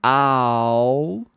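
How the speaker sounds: Owl said with ow as in cow. Shown as a demonstration. Teacher contrasting owl and all. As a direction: glide through the ow clearly. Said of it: "Olha a diferença aqui".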